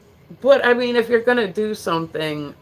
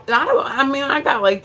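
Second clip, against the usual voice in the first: ordinary talk, nothing marked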